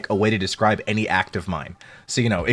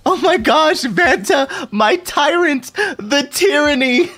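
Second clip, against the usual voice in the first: high, drawn-out theatrical wail; hammed-up high adoring cry; mock dramatic wail; 14 semitones higher, much slower than usual. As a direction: camp it up, high and slow like a swooning fan. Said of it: "And then he just goes".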